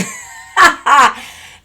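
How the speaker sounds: laughing shout, high and loud; big cackling laugh at her own insult; loud burst of laughter. Real, not acted. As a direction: crack up loudly mid-insult. Cussing out her ex.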